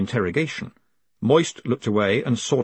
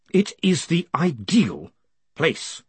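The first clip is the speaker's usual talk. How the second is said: slow, slightly raised pitch, controlled; measured ruler; 6 semitones higher, slower than usual. A narrator's reading. Vetinari overrules Greenyham's objection, slow measured authority. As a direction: overrule smoothly and deliberately.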